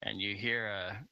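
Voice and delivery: normal talking voice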